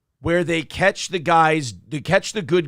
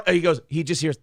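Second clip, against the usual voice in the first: ordinary talk, nothing marked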